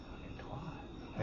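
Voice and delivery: slow, breath-only whisper; voiced inner thought. Silenced customer thinks it privately, in a slow whisper. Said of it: "my brain was just".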